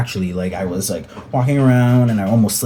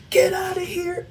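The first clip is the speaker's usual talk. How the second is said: chasers yelling. Retold or imitated. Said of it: "five guys come running".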